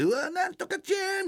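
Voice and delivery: put-on squealing high call to a child; drunk high-energy call. Drunk old man cooing at a grandchild, very high-pitched. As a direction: coo the name way up high like a tipsy grandpa. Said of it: "みたいな感じ、もうテンションめっちゃ高いの".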